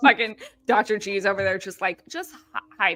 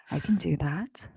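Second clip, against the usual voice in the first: whispered bedtime-story voice; ASMR whisper; 2 semitones lower, 3 dB quieter than usual. Shown as a demonstration. Co-host offering ASMR bedtime stories, whispered. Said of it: "A S M R bedtime stories".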